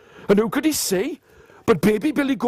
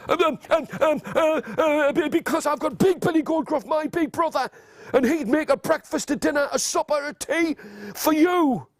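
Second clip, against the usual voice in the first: high, stammering start, then pleading; frightened middle-sized goat; 6 semitones higher than usual. Acted. Middle goat offering big brother to troll, high nervous voice. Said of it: "middle-sized Billy Goat Gruff".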